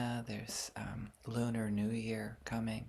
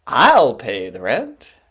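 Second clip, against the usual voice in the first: slow, loud heroic declaration; melodrama hero; 20 dB louder, slower than usual. Acted. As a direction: boom it like the gallant rescuer.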